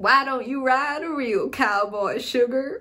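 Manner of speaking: hammed-up cowboy come-on in Southern accent; Pedro Pascal. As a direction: put on a playful Southern accent. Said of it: "with Pedro Pascal, where he's like".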